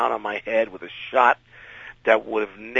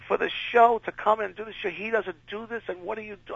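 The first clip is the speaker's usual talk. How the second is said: frantic boss; 10 semitones higher than usual. Retold or imitated. Boss's rant continues.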